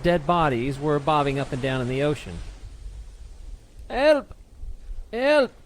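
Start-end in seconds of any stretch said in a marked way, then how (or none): 3.9-4.3 high, loud call for help; cry for help. A narrator's reading. Drowning man calls out to the ship, loud high cry. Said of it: "was heard over the growing storm". 5.1-5.5 high, loud call for help; cry for help. A narrator's reading. Drowning man calls out to the ship, loud high cry. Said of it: "was heard over the growing storm".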